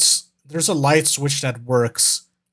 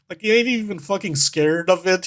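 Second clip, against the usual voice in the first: ordinary talk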